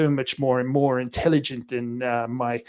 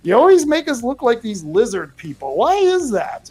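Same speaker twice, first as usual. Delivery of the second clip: a higher, whiny voice for aliens who feel wronged; complaining aliens; 8 semitones higher, 3 dB louder than usual. Pretend. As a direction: whine like aliens tired of being badmouthed. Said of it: "good space aliens ... who are going".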